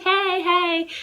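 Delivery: high, beaming "Hey, hey"; sing-song friendly greeting; smiley hello. Retold or imitated. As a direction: greet a stranger with a big grin. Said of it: "I know I'm smiley".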